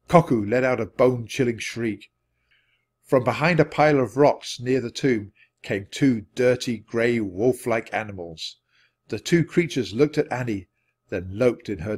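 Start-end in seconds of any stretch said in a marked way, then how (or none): none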